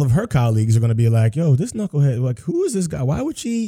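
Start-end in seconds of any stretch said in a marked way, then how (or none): 1.3-3.7 mock incredulous colleague. Colleagues mocking her partner.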